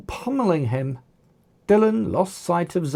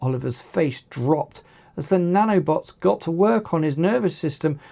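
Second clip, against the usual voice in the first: usual speaking voice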